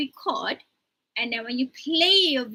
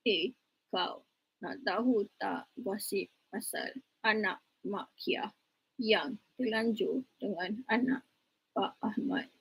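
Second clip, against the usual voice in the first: monotone; 4 semitones lower, 9 dB quieter than usual. Shown as a demonstration. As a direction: drone the gossip flat and lifeless. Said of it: "try to show you muka senada".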